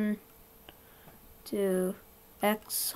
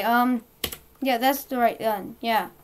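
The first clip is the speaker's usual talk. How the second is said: ordinary talk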